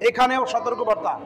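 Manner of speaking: ordinary delivery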